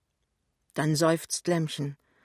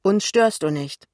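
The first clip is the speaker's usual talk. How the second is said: loud, higher, proud assurance; 4 semitones higher, 8 dB louder than usual. A narrator's reading. Son assuring his mother she is welcome, loud and proud. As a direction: declare it proudly and loudly. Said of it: "sagt Pinneberg voll Stolz".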